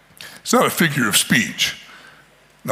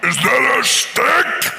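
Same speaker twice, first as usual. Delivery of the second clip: mutant voice; 9 semitones higher, slower than usual. Acted. Preacher voices mutant's question.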